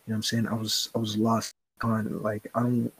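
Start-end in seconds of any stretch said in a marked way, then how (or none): none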